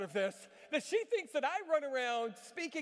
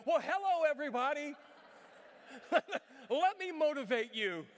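hammed-up, loud, higher showman greeting; mock preacher; 5 semitones higher, 7 dB louder than usual. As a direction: greet the diners like a stage preacher. Imagined preacher in chicken shop.

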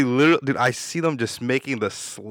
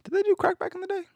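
much higher, squeaked question; asking his friend if they did crack back then; high squeaky question. Real, not acted. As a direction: pitch it way up in disbelief.

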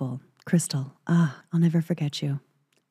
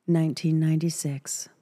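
ordinary talk

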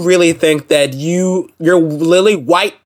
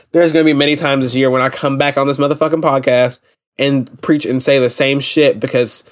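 usual speaking voice

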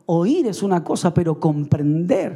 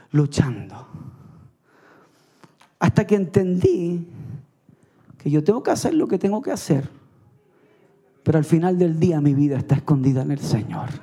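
normal talking voice

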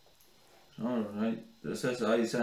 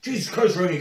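startled high yelp; shocked shout; 8 semitones higher, 9 dB louder than usual. Real, not acted. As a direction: blurt it out in shock. Shocked at friend's stunt.

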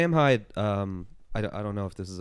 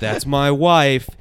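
loud, drawn-out mock protest, higher pitched; imitating Sam Hyde; 5 semitones higher, 13 dB louder, much slower than usual. Friend retells the husband's protest over his wife, slow loud mock outrage. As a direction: stretch it out as an aggrieved sketch husband. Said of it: "And Sam goes like".

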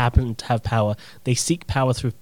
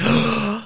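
shocked gasp; 10 semitones higher than usual. Retold or imitated. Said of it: "and I'm like".

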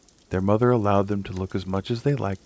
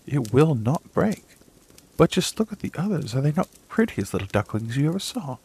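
proud mother duck; 7 semitones higher than usual. A narrator's reading. Mother duck shows off her ducklings to the old duck, higher proud voice. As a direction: boast of the little ones as a proud mother.

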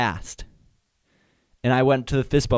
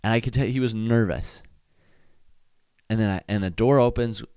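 ordinary talk

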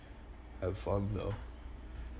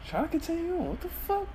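much higher squeaky voice; faster than usual. Real, not acted. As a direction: squeak it out high. Squeaky voice while tripping.

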